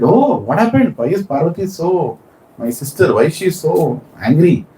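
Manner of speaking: high-pitched, concerned surprise; Narayan. Acted. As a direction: ask in acted dismay what has happened. Voices Narayan hearing alarming news.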